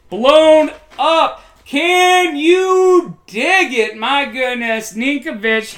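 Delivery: stretched-out hype yell, high and loud; excited shout. Real, not acted. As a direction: belt it out like a hyped announcer. Big hit on a run play.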